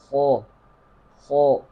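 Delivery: خو said with rounded lips, turning into o; rounded lips error. Shown as a demonstration. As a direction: round the lips on خو to show the mistake. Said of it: "ہونٹوں کو گول کر دیے جاتے ہیں". (خو ہونٹ گول کر کے، غلط)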